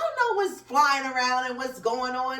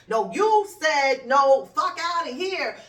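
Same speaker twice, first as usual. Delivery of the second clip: mock shout; 5 semitones higher, 9 dB louder, slower than usual. Acted quarrel between politicians.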